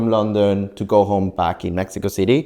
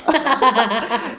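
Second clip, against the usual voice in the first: high, laughing mock what; confused what; 12 semitones higher than usual. Cashier who can't understand the water order, laughing confused what. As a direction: play the puzzled cashier with a laugh. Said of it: "And she was like".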